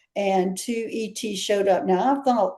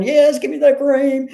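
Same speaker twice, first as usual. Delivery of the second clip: a higher, louder demanding alien voice; mock alien demand; 5 semitones higher, 6 dB louder than usual. Nurse imitates an Arcturian patient demanding cream, higher and louder. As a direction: play the bossy Arcturian asking for cream. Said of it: "the Arcturian would sit there and going".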